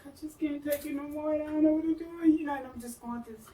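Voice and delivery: high, strained mock-tearful plea; distraught confession. Breaking down at doctor's office, high distraught plea.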